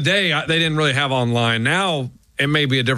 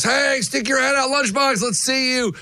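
mock fan yell; 8 semitones higher than usual. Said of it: "they'd be yelling at the box".

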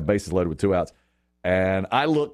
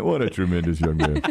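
composed, lower-pitched announcer praise; booth commentator; 5 semitones lower than usual. Retold or imitated. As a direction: praise him like an unruffled broadcaster. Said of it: "And they're like".

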